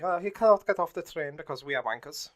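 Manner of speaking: a hint of a mocking German officer; officer refusing Schindler, lightly voiced Nazi; generic Nazi voice. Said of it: "my generic Nazi voice".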